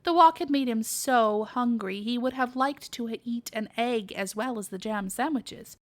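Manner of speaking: ordinary delivery, nothing marked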